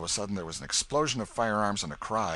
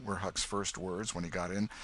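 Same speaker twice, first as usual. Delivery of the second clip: plain reading voice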